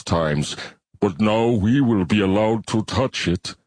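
slow, measured alien voice with a different timbre; slow alien bivalve. Acted. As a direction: speak as a calm, ponderous shellfish creature. Bivalve character voice.

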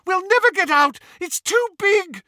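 very high, loud panicked scream. A narrator's reading. Hermione on the dragon in the tunnel, panicked scream. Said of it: "Hermione screamed".

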